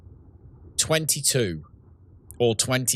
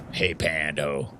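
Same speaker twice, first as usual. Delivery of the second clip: lower, slow greeting in a friend's voice; Cackling impression; 7 semitones lower, 4 dB quieter, slower than usual. Streamer imitating Cackling greeting Pando, lower and slow. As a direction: greet Pando slowly and low, imitating him. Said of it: "It's my Cackling impression".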